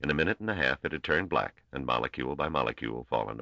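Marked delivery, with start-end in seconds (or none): none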